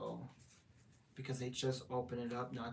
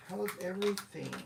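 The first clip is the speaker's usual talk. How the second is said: light put-on British accent, a bit higher; mock British accent; 6 semitones higher than usual. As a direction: play at being British for a viewer. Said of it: "My British accent".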